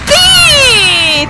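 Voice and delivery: loud, high, drawn-out cheer; triumphant cry. Real, not acted. Killer delighted the prey is stuck, triumphant cry. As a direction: shout it high and hold it. Killer catches a fleeing player.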